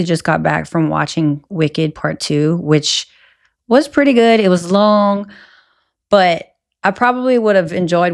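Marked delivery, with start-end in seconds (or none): none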